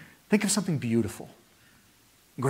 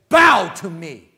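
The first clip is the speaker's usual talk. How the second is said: proud command; 4 semitones higher, 10 dB louder, slower than usual. Retold or imitated. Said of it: "you'd say".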